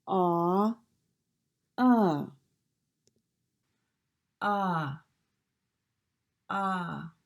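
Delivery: "ah" held open, jaw dropped, said over and over; ah vowel drill. Shown as a demonstration. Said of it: "bring your jaw up halfway".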